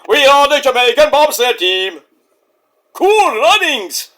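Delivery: mock Jamaican accent. Mocking Jamaican bobsled team.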